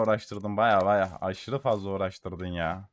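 ordinary talk

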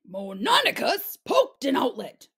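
usual speaking voice

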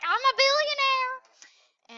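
high, loud, slow gloating cry; hammed-up gloating; mock billionaire cheer. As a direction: crow it like a rich tycoon. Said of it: "and be like".

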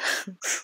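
a quiet breathy laugh she can't hold back; soft shocked giggle; stifled laugh. Real, not acted. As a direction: laugh under your breath in disbelief. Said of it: "I'm really sorry for laughing".

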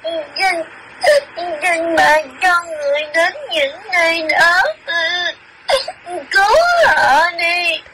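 tearful pleading through sobs; crying child. Acted. Child spirit begging grandmother, crying. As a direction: beg for help while weeping. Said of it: "Sao khóc rồi".

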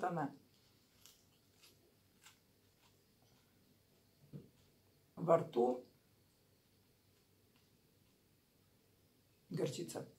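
chewing between words; talking while chewing. Real, not acted. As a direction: mouth partly full. Seen 0: Herbs bitten at -5, closed-lip chewing motions through labelled words.

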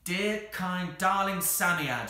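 high, loud breathless pleading. A narrator's reading. Anthea coaxes the struggling Psammead, breathless. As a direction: plead with a breathless rush of feeling. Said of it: "she said breathlessly".

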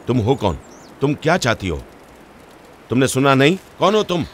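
heated questioning, pitch climbing high; angry demand. Acted. Angry demand to know who she is.

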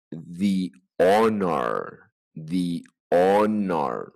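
"The honor" very slow with thee and no h; silent h honor. Shown as a demonstration. Teacher models slowly for students, "The honor". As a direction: drag out "The honor", opening on the vowel. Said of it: "el otro".

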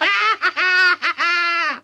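put-on high old-woman cackle; long, gleeful granny cackle; screechy high laughing. A narrator's reading. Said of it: "cackled the old woman".

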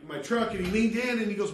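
ordinary talk, nothing marked

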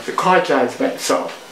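ordinary talk

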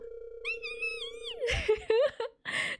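very high, soft squeal into laughter; thin, drawn-out squeak of glee; excited squeal. Real, not acted. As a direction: squeal quietly, then giggle. Overcome with excitement at game.

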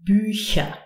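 Bücher with a soft palatal ch; long Ü, then hissed ch in Bücher. Shown as a demonstration. Teacher giving learners an example, Bücher with soft ch. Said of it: "we pronounce it Ch".

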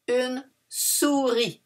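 "Une souris" drawn out, no final S; "souris" as "suri"; slow model word. Shown as a demonstration. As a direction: present "souris" slowly. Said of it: "Doesn't finish by E, but it's une".

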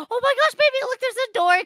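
gasp, then high-pitched excitement; high, breathless-with-surprise exclamation; excited discovery. Pretend. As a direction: urgently point out a way out. Spots a door while fleeing.

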